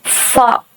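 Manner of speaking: फ as an airy, unvoiced f; English f. Shown as a demonstration. Teacher showing lips not sticking, airy English फ. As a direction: push air through for फ. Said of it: "हवा निकल रहा है".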